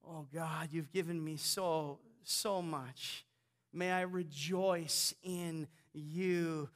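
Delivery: a hushed, humbled thank-you; soft prayer. Real, not acted. Preacher thanking God quietly before the congregation.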